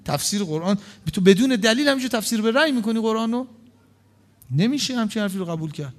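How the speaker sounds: normal talking voice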